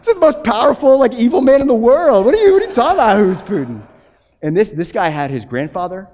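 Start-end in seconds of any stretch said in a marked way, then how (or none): 0.0-3.8 much higher, louder, laughing in shock; can't believe what he just heard; laughing disbelief. Retold or imitated. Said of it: "I was like".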